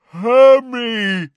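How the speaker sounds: high, loud giant roar; drawn-out creature bellow. A narrator's reading. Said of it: "roared Grawp".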